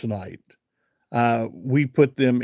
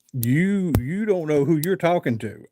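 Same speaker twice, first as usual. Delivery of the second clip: playful boast; 4 semitones higher than usual. Retold or imitated.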